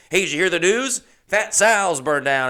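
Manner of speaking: loud, high town-crier announcement; breaking big news. Retold or imitated. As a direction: announce it like hot gossip.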